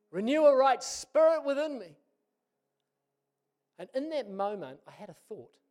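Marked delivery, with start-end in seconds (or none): 0.1-2.0 high, loud plea to God; desperate loud prayer; crying out. Retold or imitated. Repeated prayer, cried out.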